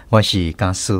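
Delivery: ordinary talk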